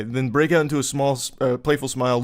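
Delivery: usual speaking voice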